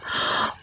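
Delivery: sharp gasp. A narrator's reading. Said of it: "my mother cried".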